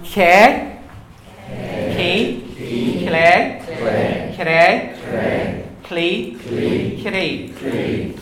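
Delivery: loud K, clay, cray with a front K; slender K drill. Shown as a demonstration. Teacher drilling the slender K syllables. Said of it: "we'll do the slender one".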